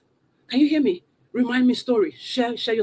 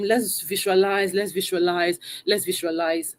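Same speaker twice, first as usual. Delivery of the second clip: slow, droning, sleepy invitation; draggy; 6 semitones lower, slower than usual. Shown as a demonstration. Coach showing viewers a dull host, slow and draggy. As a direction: drag it out with no energy at all. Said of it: "If I just come here and I'm draggy".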